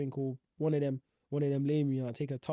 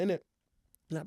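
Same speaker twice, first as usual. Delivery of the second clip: ordinary talk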